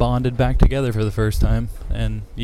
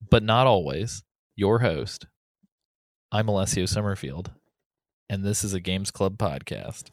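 podcast announcer; 5 dB quieter than usual. Acted. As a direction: welcome the audience like a show host. Hosts podcast intro.

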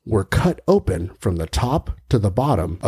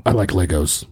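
deep Batman voice; 6 semitones lower than usual. Acted. Batman voice, silly.

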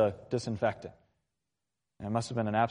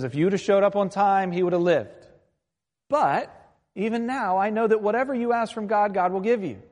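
higher, louder, urgent appeal; insistent Martha; 8 semitones higher, 5 dB louder than usual. Retold or imitated. Grieving sister answers Jesus about her brother, insistent and high. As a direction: press the point, pitched up and emphatic.

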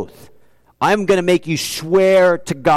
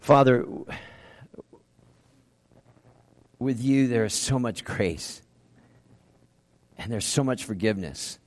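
prayer; 6 semitones lower, 10 dB quieter than usual. Acted. Preacher closing the sermon, praying softly to God. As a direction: pray quietly to the Father.

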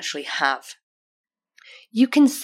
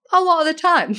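laughing; 11 semitones higher than usual. Real, not acted. Laughing at her own struggles.

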